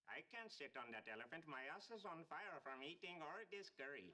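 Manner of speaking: mock Indian accent. Howard showing his Indian accent to Raj, hammed-up. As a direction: do an over-the-top Indian accent. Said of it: "let's hear your Indian".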